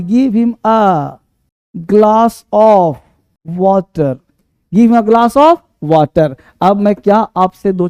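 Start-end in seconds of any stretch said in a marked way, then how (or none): 0.0-4.2 very slow, with pauses between word groups; slow dictation. Shown as a demonstration. Teacher dictating the translation to students, very slowly. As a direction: break it into pieces for writing. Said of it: "एक गिलास पानी".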